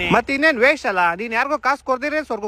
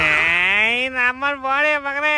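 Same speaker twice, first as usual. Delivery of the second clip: crying; 2 semitones higher, much slower than usual. Acted. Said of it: "ಯಾಕ್ ಅಳ್ತಾ ಇದ್ಯಾ?".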